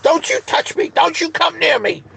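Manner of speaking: piercingly high, monotone mock screech; mocking falsetto tirade; mock going-off. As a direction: imitate her hysterical shrieking up in falsetto. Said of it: "she goes off".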